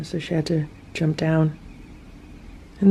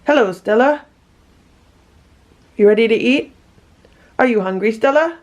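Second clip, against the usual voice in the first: high, louder questions to a cat; pet talk; 5 semitones higher, 4 dB louder than usual. Real, not acted. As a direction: ask the cat in a raised, rising voice. Talking to her hungry cat.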